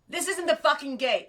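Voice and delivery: high, loud exasperated snap; mock angry sign. A host voicing the rude sign she wants, mock furious. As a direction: bark it like a fed-up host. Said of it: "That's what I want the sign to say".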